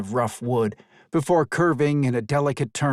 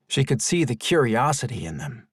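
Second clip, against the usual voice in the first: ordinary delivery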